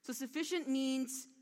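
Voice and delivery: ordinary delivery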